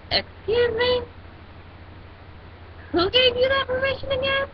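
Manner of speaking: high, haughty excuse me; indignant comeback. Retold or imitated. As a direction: pitch it up, offended and superior. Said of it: "and I'm like".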